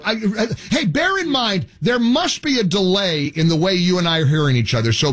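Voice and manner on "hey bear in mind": ordinary talk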